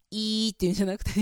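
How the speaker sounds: いー squeezed narrow; extreme narrow i. Shown as a demonstration. Voice teacher exaggerating a narrow i. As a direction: spread and pinch いー on purpose. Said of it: "今すごい極端にやった".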